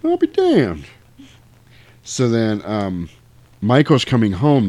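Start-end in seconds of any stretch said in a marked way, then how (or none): 0.0-0.8 mock amazement. Acts out Michael's astonished discovery.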